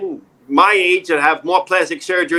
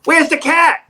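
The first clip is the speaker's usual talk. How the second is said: high called-out question; yelled out; 10 semitones higher, slower than usual. Retold or imitated. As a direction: shout the question across the park. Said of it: "a guy yells out to me".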